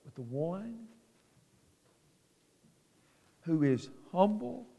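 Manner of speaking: soft, low, intimate sermon voice; hushed preaching. Acted. Preacher drops softly to his flock about God's holiness. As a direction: bring the congregation close, almost confiding.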